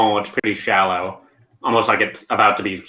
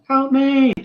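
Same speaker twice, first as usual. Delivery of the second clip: very high, shrieked plea; mock scream for help. Game master repeating the island woman's plea, higher mock scream. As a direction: push the acted cry right up into falsetto. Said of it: "It sounds like the Hello Neighbor scream".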